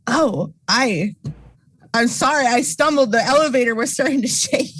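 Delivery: character voice. Pretend. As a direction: fluster an apology. Anna's character apologizing.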